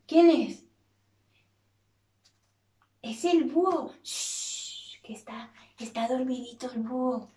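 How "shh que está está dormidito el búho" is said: whispered shush, soft words after; hushed. Acted. Teacher hushes the children, very quiet. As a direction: hush so the owl sleeps. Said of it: "owl is sleeping, hush".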